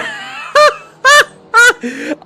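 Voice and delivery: loud, high-pitched cackling burst; incredulous laughter. Real, not acted. Commentator floored by yet another weather change, wild laugh.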